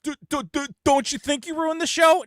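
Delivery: mocking a dumb hater. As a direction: play the caller as a fumbling idiot. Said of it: "And they go".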